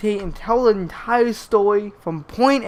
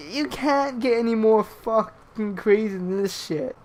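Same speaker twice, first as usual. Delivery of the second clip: ordinary delivery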